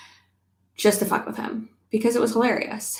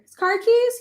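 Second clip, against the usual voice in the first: mock surprise; 14 semitones higher, 6 dB louder than usual. Joking about pockets on baby clothes, high mock question.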